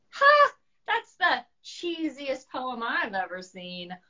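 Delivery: high loud scoffing burst; mocking dragon laugh. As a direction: scoff at the cheesy poem. Dragon mocks Monster's poem.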